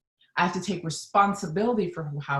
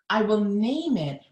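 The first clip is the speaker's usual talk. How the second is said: usual speaking voice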